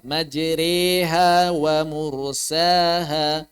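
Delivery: slow chanted "Majreha wa mursaha", long "eh" after a thin r; imalah recitation. Shown as a demonstration. Teacher recites the correct reading, slow imalah. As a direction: draw out "Majreha" with a light r. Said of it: "Jangan dibaca majreha".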